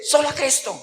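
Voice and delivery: imitating a scornful critic; mock disdain. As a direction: quote it as if scorning the idea. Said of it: "así como con desprecio".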